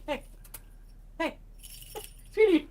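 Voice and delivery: sweet talk to the pet. Real, not acted. Cooing at pet.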